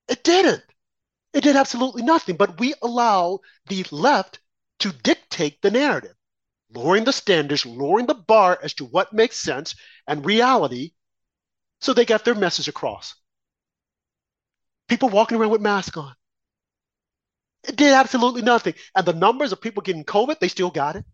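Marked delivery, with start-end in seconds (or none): 0.1-2.4 indignant, raised voice; emphatic outburst. Real, not acted. Heated rant peak. 17.6-18.7 emphatic. Real, not acted. Repeated heated claim.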